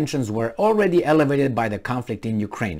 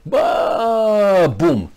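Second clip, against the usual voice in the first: imitated crashing fall; 5 semitones higher than usual. Falling from a taller building, imitated crash. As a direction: let the fall swoop, then land it. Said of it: "when you fall like you did".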